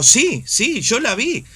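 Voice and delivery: high, quick, eager "sí, sí"; eager insistence. Retold or imitated. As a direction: insist you saw it, pitch jumping up. Insisting in retold dream.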